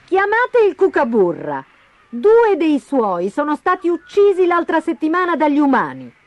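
high, forceful summoning voice; indignant prosecutor. A narrator's reading. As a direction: call the witness before the court. Prosecutor summons witness in story trial. (accusatore indignato, voce alta)

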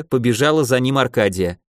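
usual speaking voice

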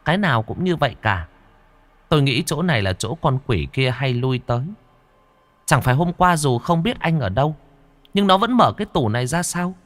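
high ghost voice, climbing toward the question; ghost companion, reasoning. Acted. Linh quỷ explains the demon's haunt to Hùng, high reasoning voice.

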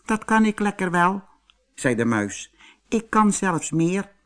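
very high, light mouse voice; little mouse. Acted. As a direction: squeak it out as a tiny mouse. Mouse answers the bull.

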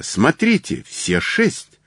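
high, urgent exclamation; eager cry without much extra volume; excited cry. A narrator's reading. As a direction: point it out in high-pitched excitement. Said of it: "закричал я".

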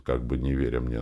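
ordinary delivery

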